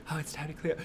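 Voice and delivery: soft little-girl murmur, mock hushed; imitated whisper. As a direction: hush it like a small girl talking to herself. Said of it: "She's, like, whispering".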